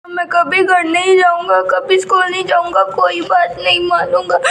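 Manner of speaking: put-on high child's voice, sobbing through the words; a little boy wailing in a tantrum; crying boy. Boy crying to the neighbour after being scolded at home. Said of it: "रोते रोते सौरभ आ जाता है।".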